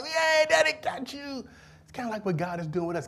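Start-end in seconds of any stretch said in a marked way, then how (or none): 0.0-1.4 put-on high, slow, delighted cheer to the child; happy daddy cheer. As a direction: celebrate catching your kid. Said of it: "and I'm happy, like".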